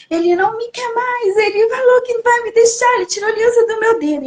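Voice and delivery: high, fast tearful rush; crying to mom. Retold or imitated. Daughter crying to her mother after the dream. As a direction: pour it out while crying.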